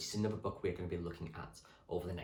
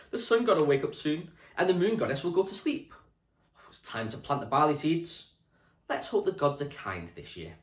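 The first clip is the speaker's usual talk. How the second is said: louder, lightly voiced hopeful farmer; voiced Neolithic farmer; 6 semitones higher, 8 dB louder than usual. A lightly voiced Neolithic farmer telling villagers the gods will wake, louder. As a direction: call out to the village that planting time has come.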